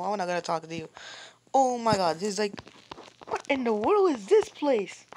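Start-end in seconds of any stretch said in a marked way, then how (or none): 3.3-5.0 astonished. Real, not acted. Amazed at new level.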